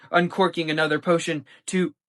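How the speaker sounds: usual speaking voice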